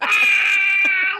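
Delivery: loud, high imitated shriek; mock howl of laughter. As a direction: imitate parents losing it completely. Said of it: "could not keep it together".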